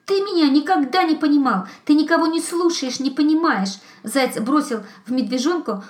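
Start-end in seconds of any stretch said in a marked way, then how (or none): 0.0-3.8 upset. Acted. Hare's tearful outburst.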